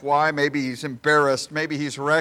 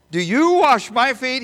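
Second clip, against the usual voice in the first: much higher and louder, leaning hard on wash; emphatic; 10 semitones higher, 8 dB louder than usual. Acted. Peter pressing Jesus, loud emphatic question. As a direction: demand it with full force. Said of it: "he becomes very emphatic".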